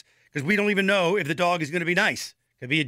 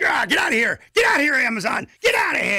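loud, high angry dog yelling; shouting grumpy dog; 10 semitones higher, 5 dB louder than usual. Pretend. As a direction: yell at the delivery truck like a cranky dog. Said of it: "Their bark is like".

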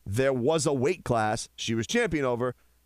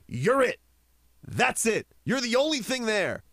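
heated, pitch pushed higher; worked up; 6 semitones higher than usual. Real, not acted. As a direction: rant with real heat. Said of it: "You're pretty emotional".

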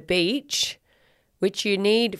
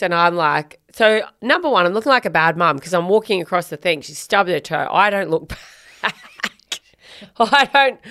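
ordinary talk, nothing marked

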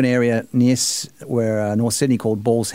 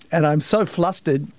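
usual speaking voice

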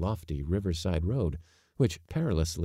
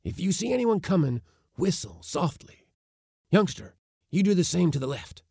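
commanding uncle; 10 semitones higher than usual. Acted. Uncle giving orders.

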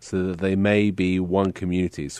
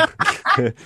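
laughing through tears; tearful laugh. Real, not acted. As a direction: let a teary laugh out.

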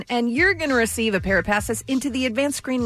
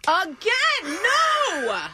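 high-pitched, drawn-out outraged no; outraged protest; 9 semitones higher, much slower than usual. Real, not acted. Co-host objecting to the underwear tip, outraged. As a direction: protest with a long, high wail.

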